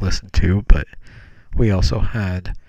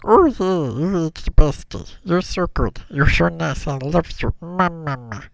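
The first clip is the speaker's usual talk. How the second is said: high, garbled stuffed-monkey voice; Stitch-like little monkey, grateful and adoring; Bob; 8 semitones higher, slower than usual. Acted. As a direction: gush like a tiny cartoon creature. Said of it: "Like, kinda like that".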